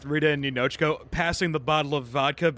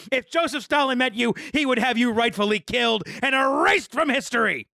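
drill instructor yelling; 7 semitones higher than usual. Acted. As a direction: berate a recruit like a drill instructor. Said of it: "[name] impersonates a drill instructor monologue".

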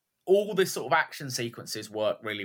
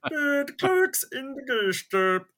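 very high, slow Swedish lilt; over-the-top Swedish accent; 12 semitones higher, slower than usual. Shown as a demonstration. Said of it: "You've gone too far now".

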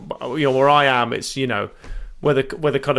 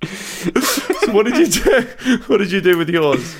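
whispered laugh through words; 6 semitones higher, slower than usual. Real, not acted. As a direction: ask while still laughing. Laughing question.

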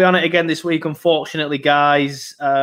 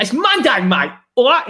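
a higher Cockney accent imitating a mate; 7 semitones higher, 4 dB louder than usual. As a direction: mimic a cheeky Cockney mate. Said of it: "in his Cockney accent".

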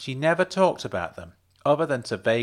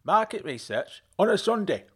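higher voice, calling back into the house; calling over shoulder; 8 semitones higher than usual. A narrator's reading. Mum calls over her shoulder to her husband. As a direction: call it to someone in the next room. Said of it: "she called over her shoulder".

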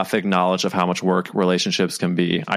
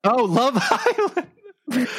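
laughing. Real, not acted. Laughs at the bad accent.

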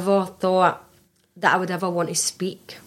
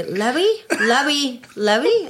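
mocking the panel; 5 semitones higher, much slower than usual. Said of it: "they'd say".

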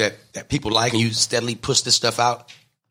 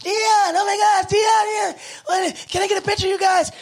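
screaming fans. Retold or imitated. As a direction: shriek like starstruck fans. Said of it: "And people are like".

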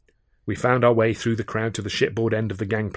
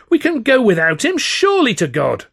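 1 frantic pleading; 13 semitones higher, 7 dB louder than usual. A narrator's reading. Pleading to leave now.

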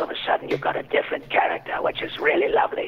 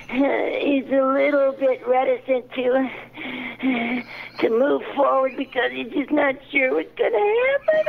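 ignorant dwarf; 3 semitones lower than usual. Acted. Improv prompt for an ignorant dwarf, whimpering and afraid. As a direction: play a scared, naive dwarf close to tears. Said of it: "An, an ignorant dwarf?".

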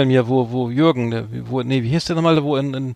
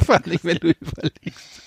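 laughing; 3 semitones higher than usual. Real, not acted. Laughs while telling funny name.

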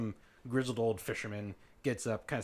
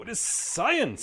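slow, emphatic, raised pitch; indignant; 9 semitones higher, 8 dB louder, much slower than usual. Acted. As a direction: insist it's science, loudly. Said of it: "It is not mumbo jumbo".

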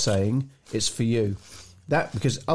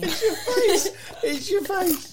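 laughing; slower than usual. Real, not acted. Teasing through laughter.

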